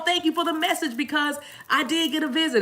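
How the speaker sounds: high-pitched imitation of a thankful fan; grateful viewer. Viewer thanking host for the message, imitated excitedly.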